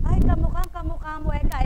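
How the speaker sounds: delighted gossip's cry; gushing neighbour. Retold or imitated. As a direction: gush over the child's likeness.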